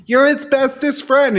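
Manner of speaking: high, loud cooing mom; mock doting mom. Host voicing the mom pleading with David, high and loud. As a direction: coo it at him, over the top.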